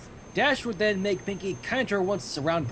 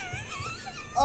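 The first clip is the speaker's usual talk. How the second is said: shrill cracked laugh; high squeal of laughter; 9 dB quieter than usual. Real, not acted. Laughs at leotard image.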